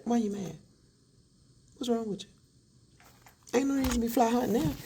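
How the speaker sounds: very high, teasing why you mad; wife mocks husband for getting mad; mocking taunt. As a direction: needle him in a sing-song.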